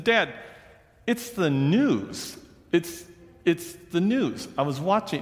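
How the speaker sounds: lightly voiced exasperated plea. Son begs dad to keep the news on, mildly pleading.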